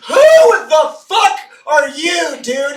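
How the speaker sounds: high, loud, slow shouted challenge; hammed-up angry manager shouting; mock manager yell. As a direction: yell it like a startled boss. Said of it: "the shift manager comes out and goes".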